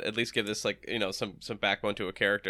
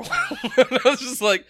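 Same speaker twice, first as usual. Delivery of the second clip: talking through a high laugh; laughing story aside, much higher and louder; 13 semitones higher, 8 dB louder than usual. Real, not acted. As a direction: laugh out the words. Laughing while retelling.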